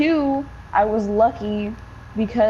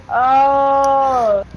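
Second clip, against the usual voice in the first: loud groaning oh; loud dismayed groan; 3 semitones higher, 6 dB louder than usual. Retold or imitated. Her own dismayed reaction.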